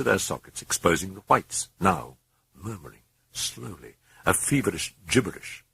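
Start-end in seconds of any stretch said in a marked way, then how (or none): none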